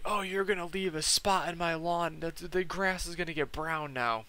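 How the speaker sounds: high, agitated boss rant; mock freak-out. Boss ranting at delivery guy about his lawn, mock freak-out. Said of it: "starts freaking out on the guy".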